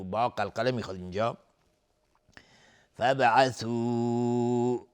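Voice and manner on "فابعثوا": "فابعثوا" with the final u held very long; louder, slow "فابعثوا", stretching the last vowel; long madd. Shown as a demonstration. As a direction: hold out the end of "فابعثوا" for several beats. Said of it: "مد جایز منفصل به مقدار چهار تا پنج حرکت".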